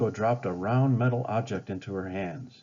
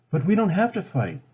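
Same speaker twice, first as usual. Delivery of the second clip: higher, louder plea to her father; pleading; 8 semitones higher, 6 dB louder than usual. A narrator's reading. Pocahontas pleading with her father, earnest. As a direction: urge him not to fight. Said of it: "Pocahontas told her father".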